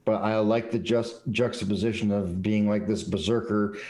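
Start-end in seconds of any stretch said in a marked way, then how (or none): none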